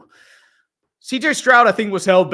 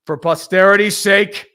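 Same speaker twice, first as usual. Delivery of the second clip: usual speaking voice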